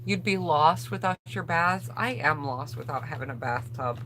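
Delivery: usual speaking voice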